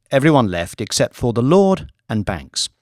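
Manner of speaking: usual speaking voice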